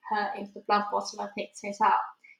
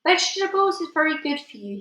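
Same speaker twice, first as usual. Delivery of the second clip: high, sing-song advice; lifted, persuasive giant voice; coaxing giant; 7 semitones higher, 4 dB louder than usual. Acted. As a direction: chirp it like a helpful nag. Giant coaxes with health advice.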